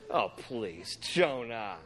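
low, quiet exasperated aside; unimpressed, said low and soft. Acted. Preacher reacting to Jonah's dramatic prayer, exasperated.

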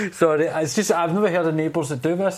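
normal talking voice

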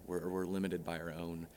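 usual speaking voice

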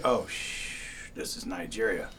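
soft, hushed mock dismay; deflated realization. Narrator discovering the new book's setting, quietly deflated. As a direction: let the voice drop away as it sinks in.

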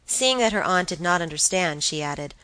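plain reading voice

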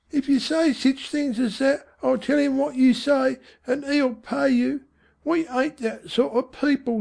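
high-pitched Cockney mother; uneasy scolding mother. Acted. Voices mother scolding son.

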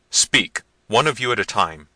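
loud, stern order; commanding prince. A narrator's reading. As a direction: order the servants firmly and loudly. Manfred orders the servants.

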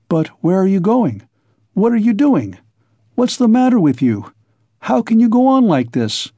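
high-pitched, desperate questioning; at wits' end. A narrator's reading. Friend pleading with the man walking away, at wits' end. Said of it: "at his wits' end".

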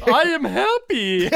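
forced happiness. Retold or imitated. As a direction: grit out a fake happy line.

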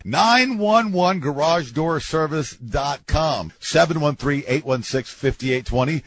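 slow, punchy ad announcer read; radio ad read. Acted. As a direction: sell it like a local radio spot. Reads a radio ad.

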